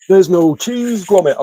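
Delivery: put-on high-pitched, botched go at a Yorkshire accent; Wallace, Yorkshire accent. Streamer mimicking Wallace and Gromit for viewers, hammed-up Yorkshire accent. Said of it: "I can't even do a Yorkshire accent".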